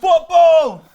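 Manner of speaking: very high, loud yell; shouted answer. Real, not acted. Co-host choosing between sex or football, high yell. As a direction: belt out the answer with no hesitation.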